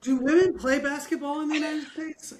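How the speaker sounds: mock naive question. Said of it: "I'm joking".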